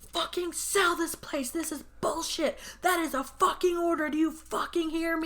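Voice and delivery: imitating a dad going off on his son; mock furious dad. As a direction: bark orders like a raging dad. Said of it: "going off on this kid".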